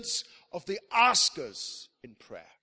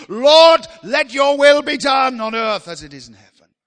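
building to a loud, high cry on Lord; full-force preaching prayer; 5 semitones higher, 13 dB louder than usual. Acted. Preacher voicing a persistent prayer to God, building to a shout. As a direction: pray like you're pounding on heaven's door.

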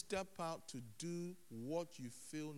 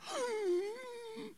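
high, drawn-out mock gasp; exaggerated high intake of breath; acted gasp; 3 dB louder than usual. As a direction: gasp in put-on dismay. Acts private reaction after agreeing.

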